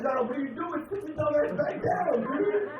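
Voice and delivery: high, loud yell at a friend; loud yell at John to sit down; exasperated yell. Retold or imitated. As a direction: bark at him to sit back down.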